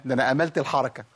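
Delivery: ordinary talk, nothing marked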